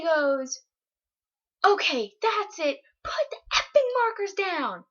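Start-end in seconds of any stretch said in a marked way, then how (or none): 1.6-4.8 exasperated, raised high-pitched order; loud outburst. Retold or imitated. Boy loses it at girls drawing pentagrams, loud outburst. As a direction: act out someone who has lost it, voice up. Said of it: "he says it so loud".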